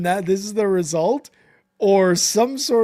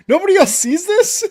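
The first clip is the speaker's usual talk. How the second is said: incredulous; 11 semitones higher, 7 dB louder than usual. Real, not acted. Echoing the disbelief, amused.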